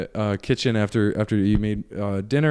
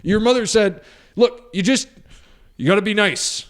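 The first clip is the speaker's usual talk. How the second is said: higher-pitched, halting dad impression; flustered angry dad; 8 semitones higher than usual. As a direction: sputter it like a dad at a loss. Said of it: "starts getting angry and starts yelling".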